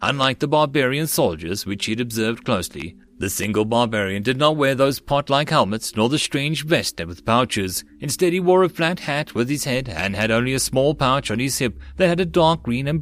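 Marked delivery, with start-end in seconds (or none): none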